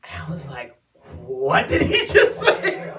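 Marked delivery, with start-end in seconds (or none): 1.2-2.9 loud, high, laughing disbelief; hammed-up stunned outrage; incredulous. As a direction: shriek it slowly through a laugh. Stunned reaction to being told obey.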